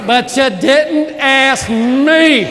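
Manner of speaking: slow, loud drawn-out complaint; mock wife complaint. Wife complaining to husband, slow mock whine. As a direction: stretch it out like a sulking wife. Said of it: "She goes".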